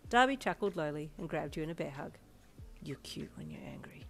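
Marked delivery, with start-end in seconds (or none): 2.8-4.0 soft unvoiced whisper in the ear; teasing ear whisper. A narrator's reading. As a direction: breathe it close to her ear. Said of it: "he whispered in your ear".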